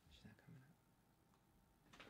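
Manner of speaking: soft unvoiced whisper, slow; hushed aside about the dog, slow. Real, not acted. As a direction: breathe it out so the dog stays asleep.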